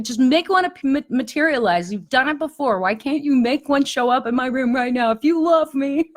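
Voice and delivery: put-on high, whiny begging like a hurt child; childlike pleading. Crying alone at night, begging God to send an angel, high and whiny. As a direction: plead up high as if throwing a little tantrum.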